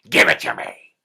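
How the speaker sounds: very low, loud hag growl; deep loud troll demand; troll queen hag. A narrator's reading. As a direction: snarl it like a grasping troll. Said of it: "And she said".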